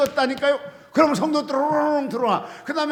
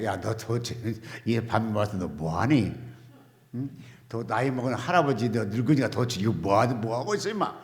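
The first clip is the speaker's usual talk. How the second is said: imagined God scolding; 14 semitones lower, 8 dB quieter than usual. Retold or imitated. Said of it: "하나님 그랬을 거예요".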